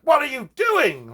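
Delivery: aunt's shout. Retold or imitated. An aunt bursts in and yells at the kids. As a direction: bust in on the kids in alarm. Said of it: "My aunt came in the room like".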